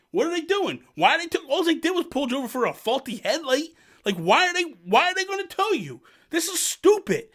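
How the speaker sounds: high-pitched, indignant retold outrage; mad friend. Friend wanting to fight over the towed car, mad and high-pitched. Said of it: "wanting to fight 'cause he's mad".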